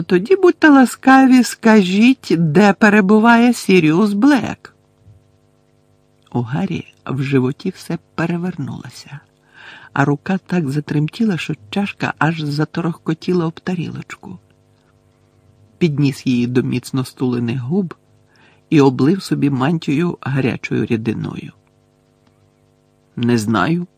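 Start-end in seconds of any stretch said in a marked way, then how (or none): 0.0-4.7 high, loud mock-polite demand; demanding interrogator. Umbridge interrogating Harry. 23.2-23.9 slightly hasty blurt; blurted a little quickly. A narrator's reading. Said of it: "трохи зашвидко бовкнув він".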